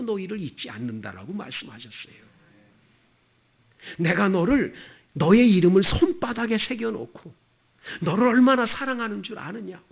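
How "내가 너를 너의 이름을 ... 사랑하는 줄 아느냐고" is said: loud proclamation. Acted. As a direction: voice of God ringing out, higher and louder. Preacher voicing God's words. (하나님의 목소리로 크게 외치듯)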